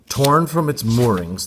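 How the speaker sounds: plain reading voice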